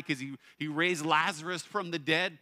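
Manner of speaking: usual speaking voice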